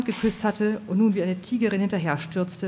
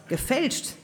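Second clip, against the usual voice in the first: triumphant; 8 semitones higher than usual. Acted. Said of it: "konstatierte Popo Scheitel triumphierend".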